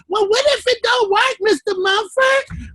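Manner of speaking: high, slowed, pleading mock student voice; mock pleading question; whiny doubter. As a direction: whine it like a doubtful student at the teacher.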